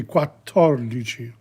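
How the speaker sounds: Quattordici with a held double T. Shown as a demonstration. Teacher modelling Quattordici for Italian learners, slow with a held double T. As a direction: lean on the TT in Quattordici, slow and clear. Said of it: "No, it's double T".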